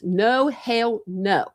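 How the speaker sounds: drawn-out hell no; slow firm refusal. Real, not acted. Vlogger refusing surgery again, slow and firm. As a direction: stretch out each no slowly. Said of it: "refusing surgery again".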